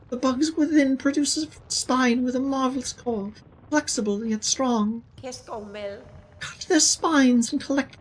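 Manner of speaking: a hint of a kindly seamstress. Voices the Seamstress character.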